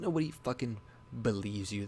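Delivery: ordinary talk, nothing marked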